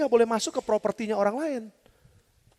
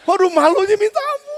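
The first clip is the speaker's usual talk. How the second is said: loud, high cry of embarrassment; hammed-up agony of shame; mortified wail; 11 semitones higher, 8 dB louder than usual. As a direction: wail it out, stretching the last word. Reliving his public embarrassment.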